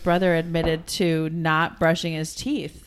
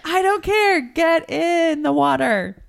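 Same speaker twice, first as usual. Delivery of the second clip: exasperated mom; 9 semitones higher than usual. Real, not acted. Exasperated at teen son.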